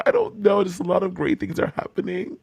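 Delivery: softening voice that keeps breaking upward; about to cry. Real, not acted. Insisting to co-host it isn't a joke, about to cry. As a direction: hold back real tears with a little laugh. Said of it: "I really am about to start crying".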